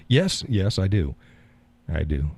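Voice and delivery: normal talking voice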